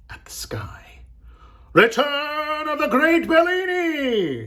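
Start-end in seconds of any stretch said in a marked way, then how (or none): 1.7-4.5 high, drawn-out showman's yell. A narrator's reading. Agent proclaims Bellini's return to the crowd, yelling. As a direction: announce it slowly to a whole square. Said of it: "he was yelling".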